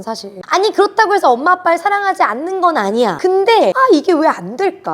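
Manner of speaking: ordinary talk, nothing marked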